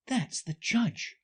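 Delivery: put-on slow, high girlish voice; Alice to herself. Alice names what she sees in court, high musing voice. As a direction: work it out aloud, high and unhurried. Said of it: "she said to herself".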